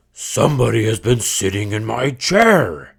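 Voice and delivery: big loud bear voice; great huge bear voice. Acted. Great huge bear finding his chair used, loud bear voice. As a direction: boom it out like the biggest bear. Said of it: "huge bear".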